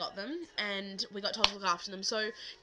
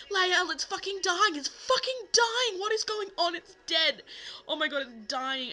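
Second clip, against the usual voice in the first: much higher, louder, slow panicked wail; panicked shouting; 9 semitones higher, 6 dB louder than usual. Retold or imitated. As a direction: shriek it down the phone in panic. Panicked call to biology teacher.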